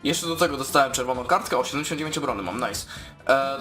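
normal talking voice

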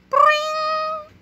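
high cartoon boing; ping it out like a spring; boing sound effect. Scene transition, a high cartoon boing.

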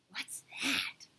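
hushed, breath-only question; awed whisper. A narrator's reading. Tiffany sees Auntie Sarah's journal, whispering in awe. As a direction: whisper it in wonder.

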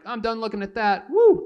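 high, loud, ending in a shrieked woo; gleeful whoop. Retold or imitated. Boy tossing a photo, ending in a gleeful whoop. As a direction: fling it off with a whoop. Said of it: "I'm like".

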